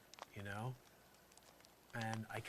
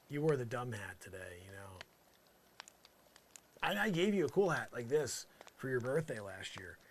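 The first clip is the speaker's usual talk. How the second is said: ordinary delivery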